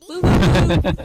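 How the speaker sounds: loud whooping cheer; excited whooping. Real, not acted. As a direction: cheer loudly like at a party. Cheering after the punchline.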